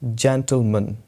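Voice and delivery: schwa in gentleman's ending; gentleman as "jentl-mun". Shown as a demonstration. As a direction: model gentleman with open e, short mun. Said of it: "word हमारा है ये है".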